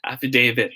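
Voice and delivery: "affidavit" with DA said as "day"; long A in affidavit. Shown as a demonstration. Teacher models the right answer, long-A affidavit. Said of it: "da will be pronounced as day".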